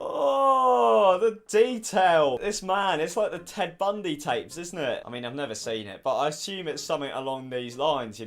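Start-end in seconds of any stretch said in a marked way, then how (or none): 0.0-2.4 disgusted surprise. Real, not acted. Reacting to the disturbing clip.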